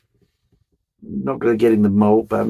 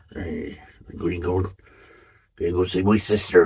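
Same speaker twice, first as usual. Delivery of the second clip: local impression; slower than usual. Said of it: "that's what they do down here".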